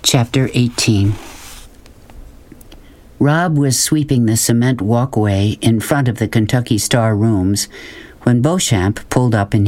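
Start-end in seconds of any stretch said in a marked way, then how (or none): none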